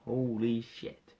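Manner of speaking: drawn-out slow holy shit; slow stunned holy shit; slow awe. Retold or imitated. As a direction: stretch it out, stunned. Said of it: "I'm there like".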